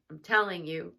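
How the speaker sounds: ordinary talk, nothing marked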